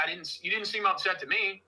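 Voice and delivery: usual speaking voice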